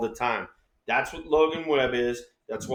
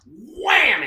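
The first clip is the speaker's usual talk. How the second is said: high, loud burst; big sound-effect "wham"; exclaimed impact; 12 semitones higher, 5 dB louder than usual. Retold or imitated. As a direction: punch it out like a sudden hit. Acting out ball hitting catcher.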